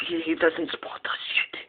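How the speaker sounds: breathy hushed whisper. Pretend. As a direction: whisper so he won't notice. Hiding close to the dog.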